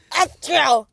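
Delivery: loud fake sneeze; hammed-up sneeze.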